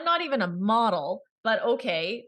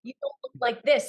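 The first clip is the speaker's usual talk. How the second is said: mock realization; 5 semitones higher than usual. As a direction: play up the embarrassed penny-drop. Self-mocking realization acted in story.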